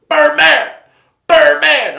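angry shout calling someone out; furious yell. Retold or imitated. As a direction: bellow the name to call him out. [name] yelling for [name].

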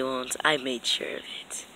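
hushed menacing whisper; villain whispering menace; sinister whisper. Acted. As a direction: whisper it like a threat. Villain threatens captive.

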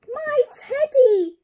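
loud, high, drawn-out storybook cry. Acted. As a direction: cry out slowly and high, as the little boy. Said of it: "cried Eddie".

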